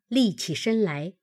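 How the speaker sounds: ordinary delivery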